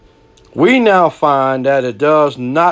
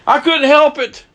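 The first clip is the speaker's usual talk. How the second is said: high pleading whine, excuses tumbling out; mock a guilty man pleading he couldn't help it; hammed-up high whine of a man making excuses to the preacher; whiny excuse; 8 semitones higher than usual. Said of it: "don't come up to me and say".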